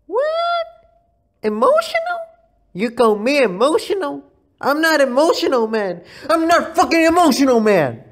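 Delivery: fly off the handle, playfully; mock outburst. Vlogger denying he's emotional, hammed-up angry shout.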